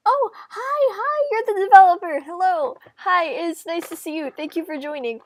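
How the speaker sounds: real surprise at a favourite guest showing up; excited greeting. Real, not acted. Spotting the developer in chat.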